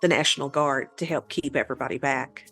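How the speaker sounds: ordinary talk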